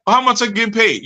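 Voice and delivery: mock greedy student. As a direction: ask it like a hopeful newcomer.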